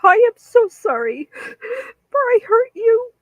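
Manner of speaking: high, sobbing apology breaking into tears; real crying. Real, not acted. Apologizing to Beyond and her friends, sobbing. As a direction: voice cracking high with real tears, a bit slow. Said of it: "you hear me crying".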